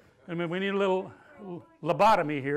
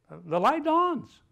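drawn out; 8 semitones higher, 7 dB louder, much slower than usual. Shown as a demonstration. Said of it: "preacher draws it out".

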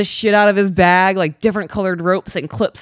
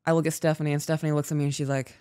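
normal talking voice

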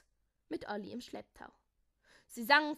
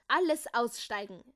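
loud, commanding call; 10 dB louder than usual. A narrator's reading. Jakobus orders everyone out of the vehicle, calling out. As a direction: call it out to all passengers. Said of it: "rief er".

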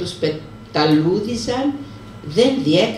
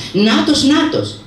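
high, louder cry of terror; frightened shout; 8 semitones higher, 4 dB louder, slower than usual. Acted. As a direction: call it out wide-eyed with fear. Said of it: "φώναξε πάλι η Εφεντίνα". (τρομαγμένη φωνή)

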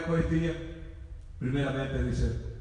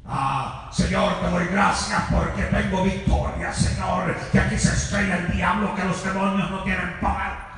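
showy triumphant prayer; 2 semitones higher, 5 dB louder than usual. Retold or imitated. Prayer changes after hearing cough.

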